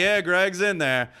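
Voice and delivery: retold called-out answer. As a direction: holler it back, drawing the words out. Calls back answer to armed man.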